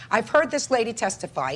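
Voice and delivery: ordinary talk, nothing marked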